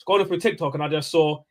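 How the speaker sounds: normal talking voice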